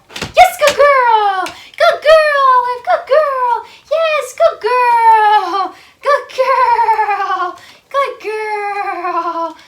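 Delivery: high, loud, drawn-out praise; squeaky praising a dog. Real, not acted. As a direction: gush over the dog in a squeaky voice. Praising dog after trick.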